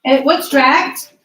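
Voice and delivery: loud, slow call to the class. Real, not acted. Teacher calls the question out to her class, loud and slow. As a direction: raise the voice to get the class's attention.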